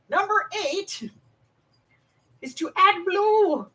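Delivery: high, drawn-out call-out; sing-out announcement, slow and high; announcer call. Pretend. Calling out numbered instruction to audience.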